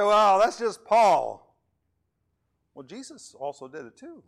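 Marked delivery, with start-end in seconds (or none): 0.0-1.6 high, loud, slow mocking voice; a put-on voice brushing off Paul; mock objector. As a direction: drag it out like a dismissive listener.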